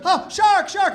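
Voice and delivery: high, loud mock panic; a swimmer screaming that a shark is coming; panicked shark cry. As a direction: shout the warning in comic alarm.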